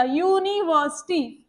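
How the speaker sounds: University said slowly, opening on a clear yu sound; slow teaching model of university; slow careful word. Shown as a demonstration. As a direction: draw out university for the class, starting with yu. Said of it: "ये चीज को आप समझना".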